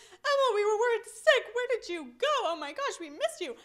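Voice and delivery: high, loud, lightly voiced worried mother; a hint of a frantic mom. Mother rushing over to her lost daughter, a touch frantic. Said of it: "she was like".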